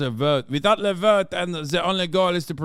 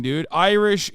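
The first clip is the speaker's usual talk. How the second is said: normal talking voice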